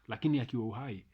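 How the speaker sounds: ordinary talk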